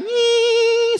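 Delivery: very high, held "nying" whine; mosquito whine. Acted. Comic imitating a mosquito flying by, high whine. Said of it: "Ada nyamuk terbang biasanya kan".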